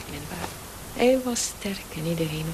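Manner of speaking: ordinary delivery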